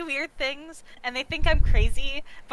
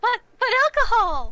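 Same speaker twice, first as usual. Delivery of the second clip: put-on higher, louder childish whine; whiny protest; 9 semitones higher, 6 dB louder than usual. [name] protests that she is only getting water, with a higher, louder whine. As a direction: plead for the drink like a sulky kid.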